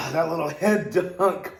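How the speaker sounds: gasping after a cold plunge; breathless from the icy water; in ice cold water. Real, not acted. Just dunked head in cold plunge.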